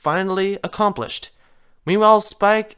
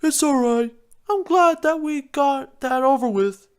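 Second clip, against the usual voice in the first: higher, easygoing Spike; 9 semitones higher than usual. Acted. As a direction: shrug it off. Spike voiced.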